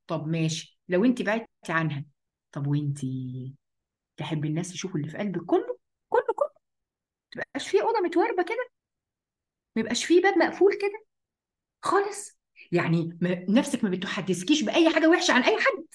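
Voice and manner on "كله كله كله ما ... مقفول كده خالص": incredulous challenge. Acted. Challenges listener, exaggerated disbelief.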